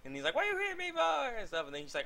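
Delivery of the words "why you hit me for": much higher, squeaky put-on whine; mock whine. Rock Lee whining to Sakura after she hits him, high squeak. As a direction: squeak it out like a hurt cartoon kid.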